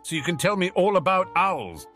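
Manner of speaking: high, loud, eager rush of interest; excited. A narrator's reading. Percy seizing on exam talk, excited. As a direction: burst in keenly, pitch up. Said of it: "Percy said excitedly".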